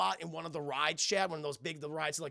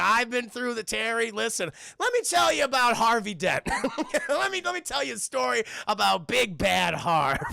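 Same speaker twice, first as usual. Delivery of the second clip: louder, higher mock old-timer storytelling; old Bruce rambling; 7 semitones higher, 6 dB louder than usual. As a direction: ham up a grizzled veteran. Bruce voiced telling old stories.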